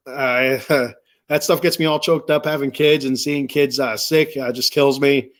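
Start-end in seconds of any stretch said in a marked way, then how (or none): none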